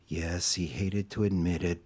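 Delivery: usual speaking voice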